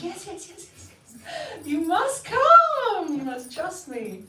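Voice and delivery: put-on woman urges a scared girl upstairs, high and insistent; insistent coaxing. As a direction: coax a frightened girl, high and eager. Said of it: "And I'm like".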